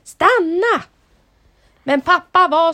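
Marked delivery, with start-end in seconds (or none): 0.0-0.8 high, loud shout of stanna; the creature yelling at the dad to stop; calling out. Acted. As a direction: shout stanna after him. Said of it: "ropade varelsen".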